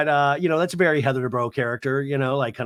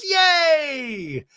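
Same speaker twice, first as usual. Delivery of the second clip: high hammed-up yay; wife cheering in mock delight; 13 semitones higher, 3 dB louder than usual. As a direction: squeal a fake cheer. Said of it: "And she goes".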